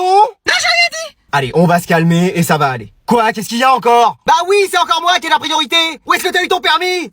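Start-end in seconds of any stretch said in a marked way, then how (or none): 0.0-1.2 high sheepish take-back; sheepish squeak. Acted. Son backs off in falsetto. 4.2-7.1 road rage. Acted. Mother yells at other drivers.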